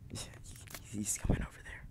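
hushed unvoiced whisper. Real, not acted. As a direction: whisper so he won't hear. Sneaking, warning about a player.

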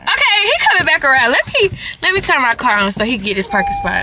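suddenly loud and high; urgent excitement. Real, not acted. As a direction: blurt it out as the car comes back. Reacts to driver circling back.

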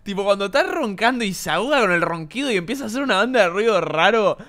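ordinary delivery

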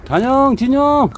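loud high call across the yard. Real, not acted. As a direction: call the dogs from far away. Calling dogs from afar.

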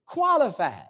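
Qualified set apart and weighted, falling; Qualified said alone, slow and firm; emphasis on qualified. Shown as a demonstration. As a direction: lean hard on Qualified as its own word. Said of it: "let me put some emphasis on that then".

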